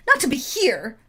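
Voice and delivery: loud angry snap; snapping. Acted. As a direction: cut him off sharply. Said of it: "the artificer snaps".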